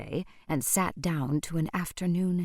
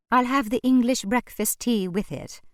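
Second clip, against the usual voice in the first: prim lady; 7 semitones higher, 6 dB louder than usual. A narrator's reading. Miss Watt orders tea.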